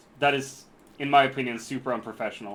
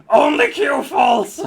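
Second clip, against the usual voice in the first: high slow shout; 13 semitones higher, 13 dB louder, slower than usual. Acted.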